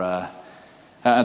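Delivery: normal talking voice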